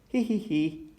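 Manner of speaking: high acted hee-hee; storybook tittering; giggling. A narrator's reading. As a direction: read out a light, high giggle. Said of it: "Everyone laughed".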